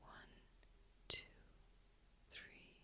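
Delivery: barely audible, spaced-out counting; hushed breath count. Acted. Guide counts a listener's inhale, very hushed. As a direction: count very faintly, leaving room to breathe.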